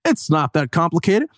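high, scoffing mock laugh and boast; mocking know-it-all. Mocking smug people who just say no, in a high scoffing voice. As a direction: lampoon the smug people who say it's easy. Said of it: "there are always people that are like".

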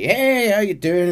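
high, loud, folksy hello; overly friendly greeting. Retold or imitated. Hosts greeting Arthur at their door, a loud, high, folksy hello. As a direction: greet the stranger with a big, too-friendly welcome.

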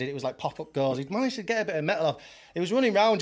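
ordinary delivery

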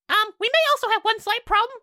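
high hag excuse; Hagatha voice. Acted. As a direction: whine it like an old hag. Hagatha reports problem.

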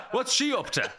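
put-on high, loud old farmer voice, laughing; elderly farmer. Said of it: "I sounded like an elderly farmer".

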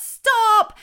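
sudden loud, high shout; red light command. Acted. Red light halting the racing cars, shouted. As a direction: shout it abruptly to halt the chaos. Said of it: "red light shows up and says".

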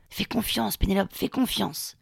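hushed and slow, soothing; soft coaxing healer. Retold or imitated. Healer murmurs to nervous patient on massage table, hushed and slow. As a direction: lull her into trusting you.

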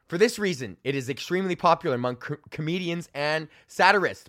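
ordinary talk, nothing marked

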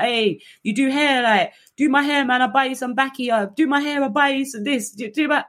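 high, pushy begging voice; pestering inmates. Retold or imitated. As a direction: nag her for a hairdo like a bunch of inmates. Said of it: "they were like".

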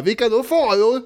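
high, mock challenging question; hammed-up demanding retort; childish heckling. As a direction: heckle him like a five-year-old. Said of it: "som ställer motfrågor på hans påståenden".

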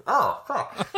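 sour reaction. Real, not acted. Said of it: "that is so sour".

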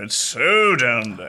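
low, slow, drawn-out butler impression; Mister Carson. Showing the host how low the butler sits, in Mister Carson's bassy voice. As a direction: sink down slowly into Carson's bassy voice. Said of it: "his actual voice is so low and bassy".

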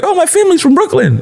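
very high, quick falsetto imitation; mocking braggart. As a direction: squeal it fast like a silly pretender. Mocking people claiming Brooklyn relatives.

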